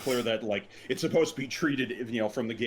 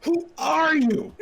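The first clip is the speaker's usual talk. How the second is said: high squeaky voice; 7 dB louder, much slower than usual. Acted. Anime character startled greeting.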